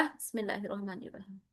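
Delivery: very soft, rapid undertone; murmured invocation. Real, not acted. Teacher murmuring bismillah before the lesson, hushed and quick. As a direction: say it low and fast, almost to yourself.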